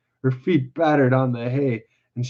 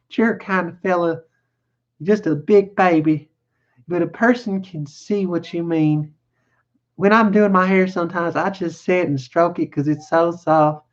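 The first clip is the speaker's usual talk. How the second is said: higher woman's voice, confiding; chatty ranch wife; 5 semitones higher than usual. Acted. Wife chats to simple man about her hair, high feminine voice. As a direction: play a wife idly talking about herself.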